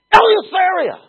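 Eleutheria thrown out high and loud; shouted Eleutheria. Shown as a demonstration. Preacher proclaiming Eleutheria to the congregation, full shout. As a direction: shout Eleutheria like a battle cry.

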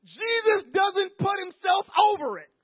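high, loud preaching cry; preaching shout. Real, not acted. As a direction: shout it from the pulpit. Preacher building to emphatic point.

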